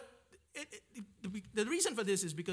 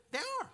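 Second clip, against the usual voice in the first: much higher, punched 'There are'; emphatic insist. Real, not acted. Preacher insisting to the class, high and emphatic. Insisting prophets came from Galilee.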